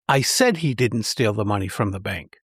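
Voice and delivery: emphatic "said", unstressed words after it; stress on SAID. Shown as a demonstration. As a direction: hit the word "said", then drop straight back down. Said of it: "The second emphasizes that I actually said it".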